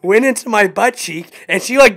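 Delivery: exaggerated complaint. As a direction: play up the pain. Telling a painful shot.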